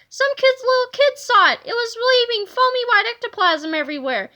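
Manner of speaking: high, loud breathless alarm; frightened cry. A narrator's reading. Wendy tells Kipper about the ectoplasm, high frightened cry. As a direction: rush the warning out, scared. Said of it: "cried Wendy Swan".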